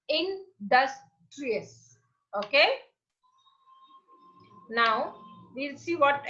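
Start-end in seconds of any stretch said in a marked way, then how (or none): 0.1-2.0 "Industrious" said very slowly, split into three syllables; syllables spelled out slowly. Shown as a demonstration. Teacher to class, "Industrious" said slowly in three syllables. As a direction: stretch "Industrious" into three clear beats. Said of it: "You break it into three".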